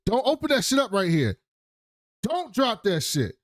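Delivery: high-pitched mock alarm; alarmed warning. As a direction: warn urgently, pitched well up.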